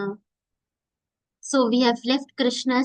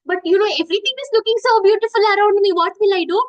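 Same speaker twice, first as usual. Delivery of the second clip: fast, louder, higher and dazzled; excited child; 7 semitones higher, 6 dB louder, faster than usual. Retold or imitated. Child at the exhibition, gushing fast and loud. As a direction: gush like a child who sees everything at once.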